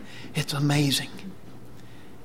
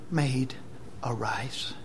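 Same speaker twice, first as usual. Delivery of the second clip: soft, low, falling command to rise; hushed retold summons; gentle command; 3 semitones lower, 8 dB quieter, slower than usual. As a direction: quietly call the dead to rise. Jesus raises the dead girl.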